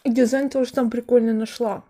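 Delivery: words muffled by food; speaking with partly full mouth; talking while chewing. Real, not acted. Seen 2: Bites pastry just before, lips and cheeks still working while speaking.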